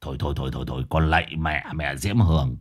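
low, muted begging off; exasperated old exorcist. Acted. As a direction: plead wearily for the ghost to stop. (giọng trầm, ngán ngẩm van xin)

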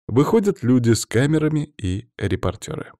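ordinary delivery, nothing marked

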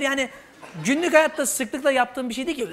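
ordinary talk, nothing marked

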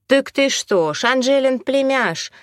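high-pitched, drawn-out amazed realisation; astonished gasp. A narrator's reading. Mop woman guesses he is Angela's nephew, astonished. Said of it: "ахнула женщина со шваброй".

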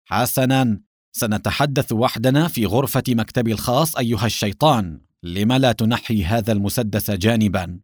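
ordinary delivery

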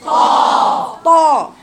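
طا loud and heavy; emphatic ta with fatha. Shown as a demonstration. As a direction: lead the class in a strong طا. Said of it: "يقولوا معايا كده".

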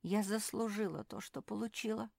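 ordinary delivery, nothing marked

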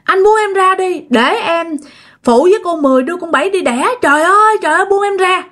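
high-pitched frantic pleading, reenacted; retold outcry. Possessed mother struggling against her husband, retold high-pitched outcry. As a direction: act out a possessed woman crying out to be let go. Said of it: "Chị thấy mẹ la làng".

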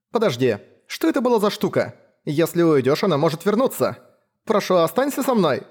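put-on high, pleading young woman. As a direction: beg him to stay, voice lifted. Leila pleads with the hunter.

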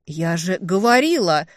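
loud exploding indignation; indignant outburst. A narrator's reading. Older sister scolding the younger, indignant outburst. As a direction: burst out in reproach. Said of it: "взорвалась негодованием я".

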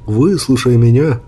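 usual speaking voice

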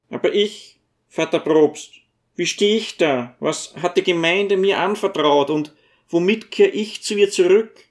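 dwarf lamenting he cannot face the congregation; distressed dwarf. A narrator's reading. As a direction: wail it up high, helpless.

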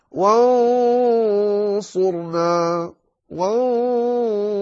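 وانصرنا with a heavy nasal on ن, slow and high; slow high recitation; heavy ikhfa before ص. Shown as a demonstration. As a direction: chant وانصرنا with a thick ghunna. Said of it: "تظهر الاستعلاء في الإخفاء".

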